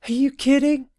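high hollered reply; hollering. Acted. Sewell hovering in the sky hollers back at her brother, high. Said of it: "she hollered back".

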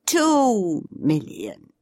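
very slow, weighty; slow and emphatic. A narrator's reading. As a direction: lean on each word slowly. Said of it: "she said slowly, emphatically".

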